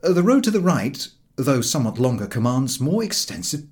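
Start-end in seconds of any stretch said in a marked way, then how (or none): none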